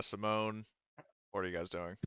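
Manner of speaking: ordinary delivery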